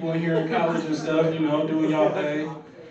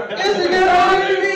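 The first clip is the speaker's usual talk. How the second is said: hyped song title; 12 semitones higher, 4 dB louder than usual. Real, not acted. Hyping the song.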